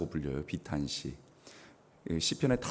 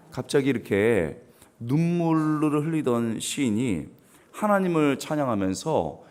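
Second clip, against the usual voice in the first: ordinary delivery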